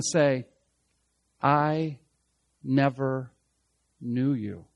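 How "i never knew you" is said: low, word by word with long pauses; solemn pronouncement. Acted. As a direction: let each word fall, lower and softer to the end. Voices Jesus' final rejection, word by word.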